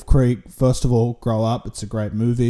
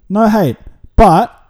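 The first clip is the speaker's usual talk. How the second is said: emphatic; 6 semitones higher, 8 dB louder, slower than usual. Real, not acted. Emphatic aside to Dennis Lillee.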